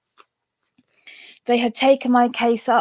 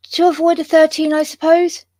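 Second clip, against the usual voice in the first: higher, louder acted question; raised questioning voice; 7 semitones higher, 4 dB louder than usual. A narrator's reading. Guest asking the maid about the missing number, higher and louder. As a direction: lift it high into a query.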